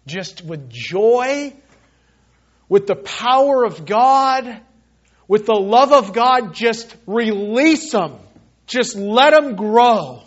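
impassioned pulpit call with high punched words; fervent exhortation. Real, not acted. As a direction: urge the congregation, stretching each phrase. Preacher exhorts congregation to forgive.

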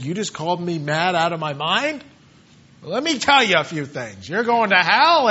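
acted indignation, building in pitch and volume; mock offended. Offended man snapping back at being called mad, mock indignant. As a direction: start low, then rise into a mock threat. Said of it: "he didn't get offended by it".

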